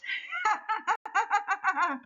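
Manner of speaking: squeaky high-pitched cartoon giggle; a tiny creature's bright chuckle. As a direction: Booga Wooga laughs at the name mix-up, high giggle. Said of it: "Booger laughs".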